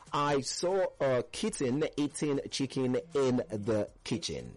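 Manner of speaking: slow, careful demo of the tongue twister. Shown as a demonstration. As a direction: spell the twister out slowly. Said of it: "So it is super simple".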